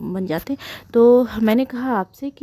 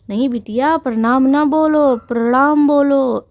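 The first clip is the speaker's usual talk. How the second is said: grandfather correcting; 5 semitones higher, 7 dB louder than usual. Retold or imitated. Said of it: "grandfather corrects granddaughter".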